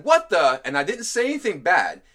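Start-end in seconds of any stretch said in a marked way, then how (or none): 0.0-0.6 loud, sudden surprised outburst; startled exclamation. Retold or imitated. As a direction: blurt it out behind the wheel. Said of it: "I said".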